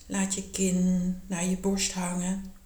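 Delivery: normal talking voice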